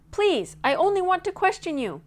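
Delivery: loud, high pleading call; calling after. A narrator's reading. Nancy calling after Grizzleface, loud, high and pleading. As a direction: shout the plea after him as he runs. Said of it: "Nancy called after him".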